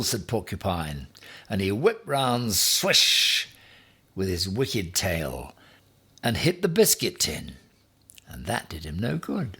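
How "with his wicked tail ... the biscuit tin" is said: ordinary delivery, nothing marked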